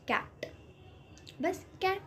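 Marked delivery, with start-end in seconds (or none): 1.8-2.0 कैट said quick and clipped; short a in cat. Shown as a demonstration. Teacher showing students the short a in कैट. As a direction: snap out कैट fast, short a. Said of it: "फटाफट ऐसे बोल के ख़त्म हो गया".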